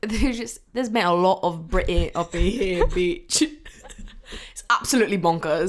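mock British accent with giggles; hammed-up British slang. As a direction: play at being British. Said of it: "talking in British accents".

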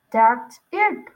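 loud, abrupt outburst; coach's yell. A narrator's reading. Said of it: "Coach had yelled from the front".

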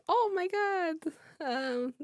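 high, slow astonished mom; amazed slow exclaim. Retold or imitated. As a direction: stretch the amazement out.

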